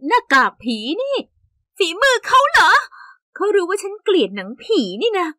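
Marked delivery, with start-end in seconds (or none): 1.8-2.7 high, loud indignant gasp of a question; outraged realisation; outraged disbelief. Acted. Discovering the ghost mask prank.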